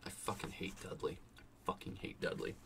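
very soft, under-the-breath cursing; muttered venting. Real, not acted. Reader's aside venting about the character.

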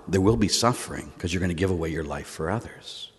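soft, low, earnest sermon delivery; hushed preaching. Real, not acted. Preacher on suffering because of love, hushed and solemn. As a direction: let it sink in quietly, with pauses.

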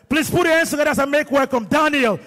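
rousing announcer call, ending on a long held word; celebratory call to applaud; MC hype. Real, not acted. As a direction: whip up the wedding crowd, stretching the final word. MC hypes the couple's entrance.

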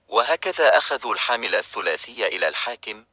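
usual speaking voice

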